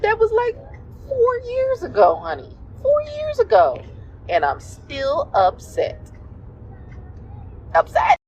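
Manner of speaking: high, loud mock indignation; gritted-teeth upset. Partner replaying an old grievance from years ago, mock upset. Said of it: "That is the grit teeth voice".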